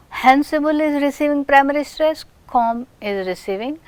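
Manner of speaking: usual speaking voice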